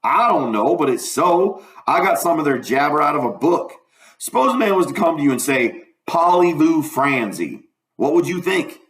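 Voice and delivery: lightly voiced country boy; a hint of a know-it-all boy; Huck, explaining. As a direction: explain it in folksy Southern dialect.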